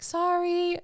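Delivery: high, sheepish little apology; mock sheepish sorry. Returning the brick to the old man, mock sheepish apology. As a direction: squeak out a guilty sorry. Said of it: "pick up the brick and be like".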